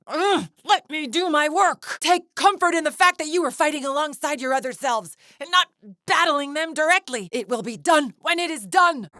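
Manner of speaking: very high, strained outburst; high-pitched, fed-up tirade; exasperated rage. Acted. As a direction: lash out in frantic indignation. Villain exasperated in the fight.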